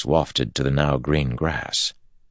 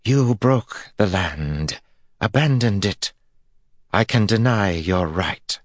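defiant; 7 semitones higher than usual. Acted. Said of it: "stared at him defiantly".

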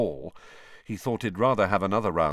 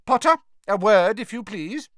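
calling above noise; 13 semitones higher, 6 dB louder than usual. A narrator's reading. Professor calling a student back as class leaves, projected. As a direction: call the pupil over in a teacher's high voice. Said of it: "called above the noise".

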